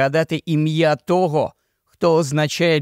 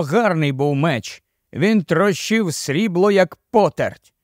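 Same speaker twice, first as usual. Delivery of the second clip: usual speaking voice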